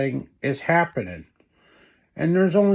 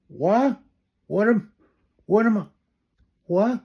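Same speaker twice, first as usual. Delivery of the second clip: confused; 5 semitones higher than usual. Acted. Stunned, cut off mid-question.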